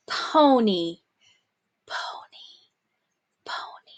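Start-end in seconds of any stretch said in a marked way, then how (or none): 1.9-3.9 soft whisper of the word. Shown as a demonstration. Teacher whispering the dictation word to the class. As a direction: hush it right down. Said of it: "dictation drill, word repeated".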